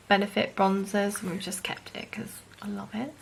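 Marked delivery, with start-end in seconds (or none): 1.2-3.1 soft confiding whisper; whispered aside. Real, not acted. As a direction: whisper it like a secret.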